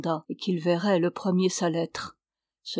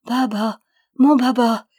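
higher, louder, overwhelmed with tears of joy; tearful joy; 4 semitones higher, 6 dB louder than usual. A narrator's reading. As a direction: cry out to the horse in happy tears. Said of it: "Ramona fondit en larmes". (retrouvailles émues, plus aiguës et plus fortes)

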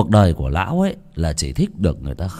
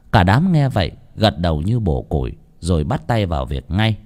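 ordinary delivery

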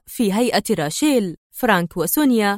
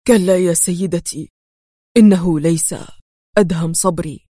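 low, halting, worn-out man; weak voice with effortful pauses; exhausted, failing voice; 4 semitones lower, 3 dB louder than usual. A narrator's reading. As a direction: drag out broken phrases as if collapsing. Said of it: "ارتفع فجأة صوت متهالك يقول".

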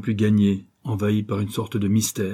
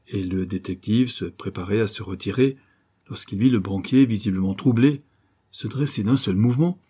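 usual speaking voice

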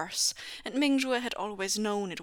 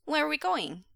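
put-on high child's question; curious little boy; 8 semitones higher than usual. A narrator's reading. Son asks father about leaving, high and curious. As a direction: ask in a small boy's raised voice.

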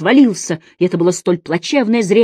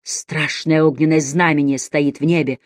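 ordinary delivery

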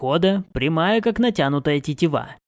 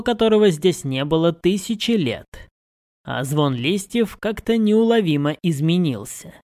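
ordinary delivery, nothing marked

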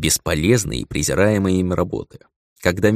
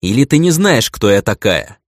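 indignant; 2 semitones higher, 6 dB louder than usual. Acted. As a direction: demand it with haughty anger. Angry noble girl demands respect.

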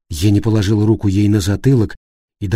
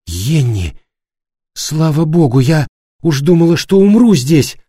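relieved cry; 4 semitones higher than usual. Acted. Rescued captive cries in relief.

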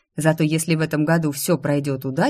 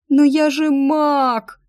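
high, slow, louder put-on wail; mock sobbing; 10 semitones higher, 5 dB louder, much slower than usual. Daughter faking sobs to sway her father, hammed-up wail. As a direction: fake the tears while watching father. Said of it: "рыдала я".

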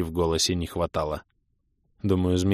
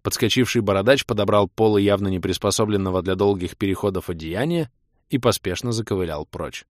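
ordinary delivery

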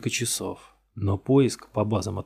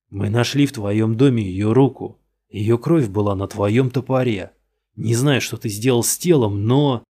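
louder, hammering out the evidence; pressing accusation; 6 dB louder than usual. Acted. Detective confronting the suspect, loud and insistent. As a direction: lean in and pile on the charges.

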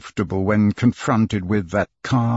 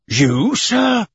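high, astonished outcry; can't believe what he's hearing; startled cry; 10 semitones higher than usual. A narrator's reading. Fix to Fogg, startled outcry. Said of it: "cried out Fix".

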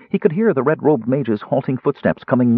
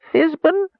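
very high mouse squeak. A narrator's reading. Kender turned mouse thanks Fizban in a squeak. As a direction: squeak it like a tiny rodent. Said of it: "Again he squeaked".

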